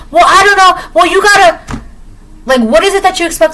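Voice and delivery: a pushy customer brushing off the rules; whiny customer. Retold or imitated. Customer pushes back at doorman.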